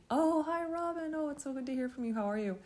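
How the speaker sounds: high, eager hello from a friend. Retold or imitated. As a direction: greet her like an old friend happy to hear from her. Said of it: "and normally".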